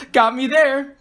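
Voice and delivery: laugh, then concede cheerfully. A narrator's reading. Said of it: "Grace laughed".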